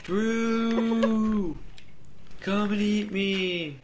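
high, slow calling voice of a sandwich; high, slow food calling his name; voiced sandwich. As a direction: lure him in like tempting food. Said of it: "sandwiches are calling my name".

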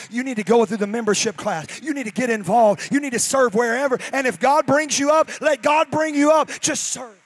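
loud, rising preacher's exhortation; preaching at full force. Real, not acted. Pastor urging the church to serve, full preaching. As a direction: drive it home to the congregation.

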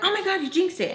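high-pitched mock exclamation; mocking imitation. People telling her she jinxed it, high mocking voice. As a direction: mimic people squealing about a jinx.

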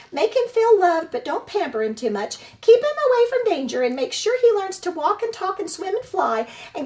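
high, loud squawking goose voice; squawking old goose. Acted. Old goose squawks at the robot about the orphaned hatchling, high and loud. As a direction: scold like an indignant old goose.

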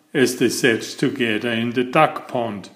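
ordinary delivery, nothing marked

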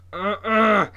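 loud, high grunted no; emphatic wordless no, pushed out loud; emphatic refusing grunt. A narrator's reading. Sister refuses to let go, emphatic grunt. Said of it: "my sister said emphatically".

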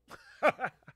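high, loud short laugh; sudden high laugh. Real, not acted. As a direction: burst out laughing. Laughing at sleeping ten hours.

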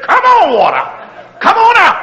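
high, loud call to the water; high excited calling out; eager call. Pretend. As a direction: cheer the water out of the rock. Congregation urged to call water out.

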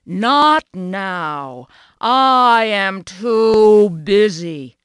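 loud, slow, cross refusal; a cow cross at being bothered, loud and drawn out. A narrator's reading. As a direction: bellow it out slowly, annoyed. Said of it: "said Cow crossly".

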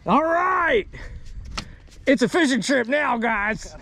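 high-pitched, elated call to the group; excited cheer. Real, not acted. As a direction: cheer it out high to your buddies. Celebrating a catch on the boat.

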